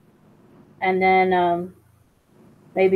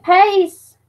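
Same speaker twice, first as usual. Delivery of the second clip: higher country drawl on Peace; country-accent farewell; 10 semitones higher, 5 dB louder than usual. Shown as a demonstration. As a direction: say it like a Southern country boy. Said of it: "gotta use the country accent".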